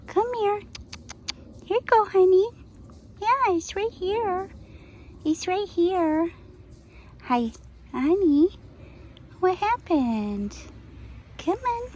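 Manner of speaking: very high, sweet calls to a pet; high coaxing call to a squirrel. Real, not acted. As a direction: coax her over in a high pet voice. Calling the pet squirrel over.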